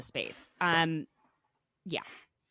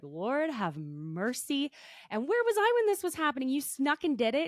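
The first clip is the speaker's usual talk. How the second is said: shocked mother; 6 semitones higher than usual. Retold or imitated. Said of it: "She's like".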